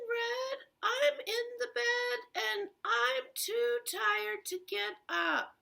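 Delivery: put-on high, weak old-lady voice with a whimper; wolf faking a frail grandmother, pitched high; sick granny put on by the wolf; wolf as grandma.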